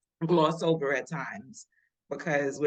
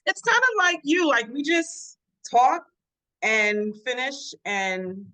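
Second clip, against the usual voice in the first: higher, louder, slow; excited; 6 semitones higher, 6 dB louder than usual. Real, not acted. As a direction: gush about it. Laughing recounting chat.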